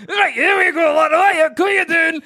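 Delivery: put-on high, shouted Scottish accent; loud Scottish accent. Alarming Scottish surgeon greeting a patient, shouted Scottish accent. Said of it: "If a Scottish guy comes in".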